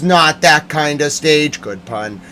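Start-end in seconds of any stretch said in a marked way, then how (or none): none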